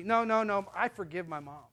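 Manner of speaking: usual speaking voice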